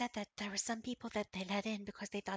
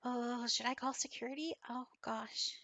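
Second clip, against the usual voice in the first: worried kitten; 5 semitones higher, 4 dB louder than usual. Acted. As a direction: fret like a small kitten. GM voices the naughty-worried kitten.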